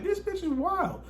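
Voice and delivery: way up high, disbelieving; can't believe what she's saying; a falsetto this bitch is wild; incredulous squeak. Retold or imitated. Inner thought, disbelief.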